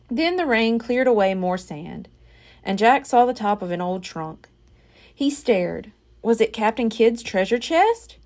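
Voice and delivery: ordinary delivery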